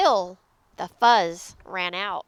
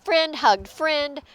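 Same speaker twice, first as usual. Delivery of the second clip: ordinary delivery, nothing marked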